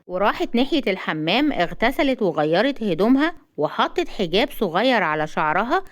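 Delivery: usual speaking voice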